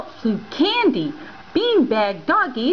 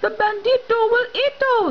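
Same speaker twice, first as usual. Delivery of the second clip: panicked cry; 7 semitones higher than usual. Acted. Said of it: "they cried".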